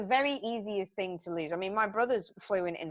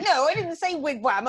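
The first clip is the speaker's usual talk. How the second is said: retold protest; 7 semitones higher, 8 dB louder than usual. Acts out daughter's protest.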